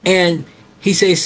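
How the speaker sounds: ordinary talk, nothing marked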